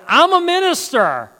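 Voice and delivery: Paul stakes his claim, slow and loud on the stripes; indignant Paul. Acted. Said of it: "Paul's going".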